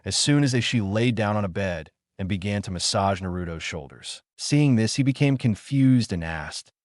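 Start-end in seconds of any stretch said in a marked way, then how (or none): none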